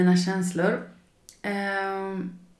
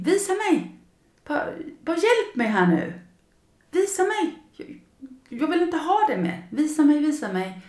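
calling out, show me; high, pleading call; 9 semitones higher than usual. Shown as a demonstration. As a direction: plead with the sky for a sign. Pleading to the universe.

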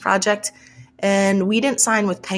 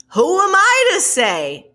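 higher, louder, drawn-out mock humility; self-deprecating deferral; 10 semitones higher, 5 dB louder, slower than usual. Author replaying how she deferred to the experts, in exaggerated humility. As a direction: throw up a loud, stretched rhetorical question. Acting out her deferring thoughts.

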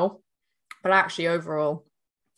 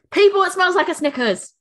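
high and loud, delighted announcement; excited; 12 semitones higher, 7 dB louder than usual. Real, not acted. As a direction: proclaim it to the listeners, thrilled. Repeated louder to audience.

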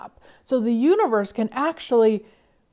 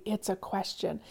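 ordinary talk, nothing marked

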